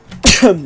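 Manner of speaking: loud sick cough; a real chesty cough. Real, not acted. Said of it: "Excusez-moi". (toux forte)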